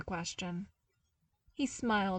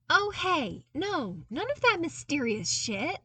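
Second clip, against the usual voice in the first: higher, louder playful protest; 9 semitones higher, 6 dB louder than usual. Acted. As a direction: wave off the gloom, protesting. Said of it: "Luke protested".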